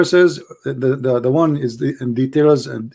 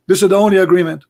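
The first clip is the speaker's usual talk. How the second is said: emphatic; 6 semitones higher, 4 dB louder, faster than usual. Real, not acted. Mocking punchline, raised voice.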